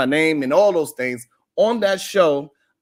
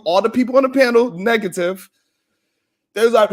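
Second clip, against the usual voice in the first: normal talking voice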